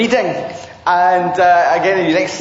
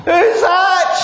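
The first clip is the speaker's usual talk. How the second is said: calling out; 10 semitones higher than usual. Acted. Man on cliff hears voice.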